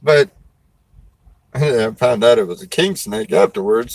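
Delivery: ordinary talk, nothing marked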